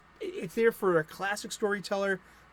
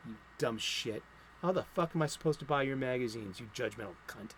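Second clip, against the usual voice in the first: low, quieter cursing as the rant sinks; seething anger; 5 semitones lower, 7 dB quieter than usual. Real, not acted. Comic fan still cursing the writer who blocked him, seething. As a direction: curse them bitterly under the rant. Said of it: "I am so angry".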